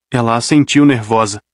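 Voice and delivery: usual speaking voice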